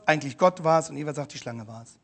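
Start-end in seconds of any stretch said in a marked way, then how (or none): 1.3-2.0 much lower, much softer, a throwaway mumble; Eva's excuse, fading. Acted. Prediger wiederholt Evas Ausrede leise, beiläufig. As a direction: let the excuse die off meekly. Repeated quote of Eva, dropping away.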